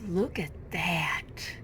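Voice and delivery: hushed, slow whisper. Real, not acted. Gardener whispers over a fragile plant, in awe. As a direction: breathe it out in awe.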